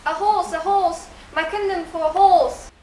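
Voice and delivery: declaimed. Acted. Acting Shakespeare in class.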